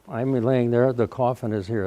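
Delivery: normal talking voice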